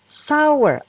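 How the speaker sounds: sour said as sawer, high and clear; teacher showing how to read sour; model pronunciation. Shown as a demonstration. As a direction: say sour slowly as two beats, sa-wer. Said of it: "Ini dibacanya sour".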